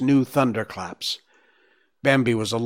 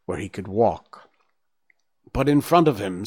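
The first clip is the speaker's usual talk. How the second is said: plain reading voice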